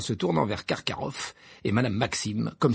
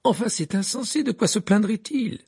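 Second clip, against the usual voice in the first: high, loud indignant cry; Fleur exclaiming; 10 semitones higher, 5 dB louder than usual. A narrator's reading. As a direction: stamp your foot in outrage. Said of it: "s'écria Fleur de la Cour en tapant du pied". (Fleur s'écrie avec indignation)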